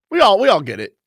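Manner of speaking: ordinary talk, nothing marked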